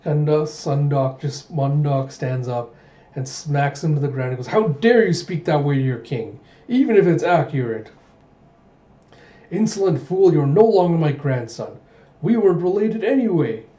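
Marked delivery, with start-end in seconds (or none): 4.5-13.7 loud, acted outrage; hammed-up family quarrel; indignant scolding. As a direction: scold like an offended grandfather, voice raised. Mundok smacks and scolds his grandson.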